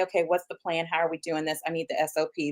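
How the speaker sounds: dropped-down voice of a practical coach; coach mom. Retold or imitated. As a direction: imitate a mother getting straight down to planning. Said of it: "mom was our coach".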